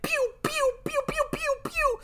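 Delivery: squeaky pew pew gun noises; high pew pew sounds. Retold or imitated. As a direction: fire off tiny laser pews. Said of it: "he was like".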